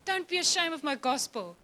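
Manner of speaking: usual speaking voice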